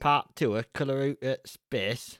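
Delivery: slow, broad northern English vowels; name the film like a northern English granddad, slowly; granddad's northern accent. Acted. Granddad announcing the sequel, slow northern English accent. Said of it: "That's what my granddad would say".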